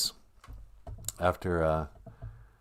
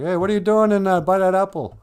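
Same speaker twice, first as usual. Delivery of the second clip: high, louder startled call; surprised Adam; 9 semitones higher, 4 dB louder than usual. Acted. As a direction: catch her by the tree, startled. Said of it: "He's like".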